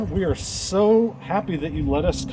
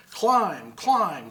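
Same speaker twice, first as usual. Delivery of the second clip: plain reading voice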